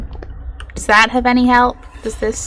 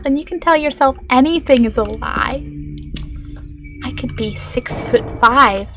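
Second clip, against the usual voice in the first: ordinary talk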